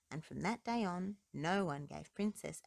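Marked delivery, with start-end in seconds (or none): none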